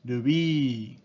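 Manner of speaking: rubí as rubi, long final i; rubí with a flat Japanese i; Japanese loanword. Shown as a demonstration. Teacher giving the Japanese reading of ruby: ルビー. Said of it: "rubí se convierte en".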